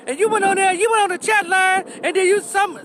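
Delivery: imitated accusation. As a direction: act out a heated accusation in a high voice. Acting out couple's quarrel.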